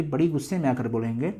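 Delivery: usual speaking voice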